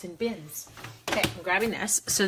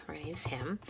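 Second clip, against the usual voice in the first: whisper; 3 semitones lower, 10 dB quieter, faster than usual. Real, not acted. Whispered aside while stamping.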